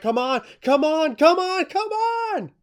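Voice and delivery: high, escalating plea stretched on the last one; frantic crowd urging. Acted. Wrestling fans urging the referee through a slow count, frantic pleading. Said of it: "everybody's like".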